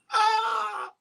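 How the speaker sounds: sudden very high mock shriek; a hammed-up cry of terror; mock horror scream. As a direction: shriek as if doomed to hell. Mock horror at hellfire list.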